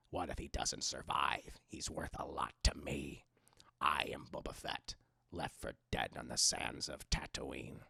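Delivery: very low, quiet, slow growl; growly Boba Fett. Acted. Streamer redoing the lines for chat, growly low bounty hunter. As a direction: growl it deep and hushed, with measured pauses. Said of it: "I'll do him in the growly Boba Fett voice".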